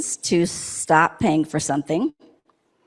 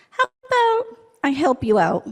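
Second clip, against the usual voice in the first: higher, lightly voiced helpful bear; eager bear; 8 semitones higher than usual. Bear offering to carry the heavy bag, lightly voiced and higher. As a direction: offer it up sweetly, a touch higher.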